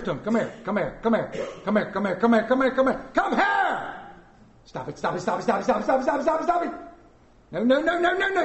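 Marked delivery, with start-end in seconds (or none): 0.2-3.9 rapid barrage. Acted. Parent summoning a child, machine-gun calls rising to a yell. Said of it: "send a barrage of "come heres". 4.6-8.4 rapid-fire chant climbing steeply in pitch; auctioneer-like rattle. Acted. Parent scolding children, auctioneer patter rising higher and higher. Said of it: "You sound like a tobacco auctioneer".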